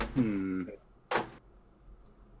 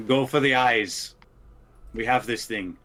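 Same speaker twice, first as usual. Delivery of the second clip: battle shout; 3 semitones higher, 6 dB louder than usual. Acted. Paladin calling back to the others, shouting. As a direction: shout it out to the whole party.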